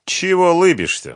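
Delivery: sharp, high, loud challenge; suddenly suspicious. A narrator's reading. Фёдор to the grinning Сухарев, suddenly suspicious. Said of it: "вдруг заподозрил неладное".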